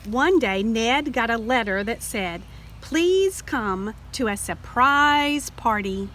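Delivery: ordinary delivery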